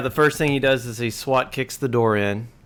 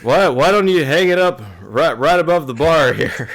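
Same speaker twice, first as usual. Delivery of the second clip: louder, higher wait-wait objection; loud protest; 5 semitones higher, 7 dB louder than usual. Acted. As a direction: stop her and insist it hangs over the bar. Lenny protests, wants duck displayed.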